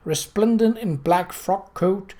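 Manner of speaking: usual speaking voice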